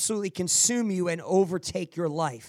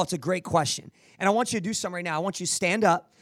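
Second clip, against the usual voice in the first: normal talking voice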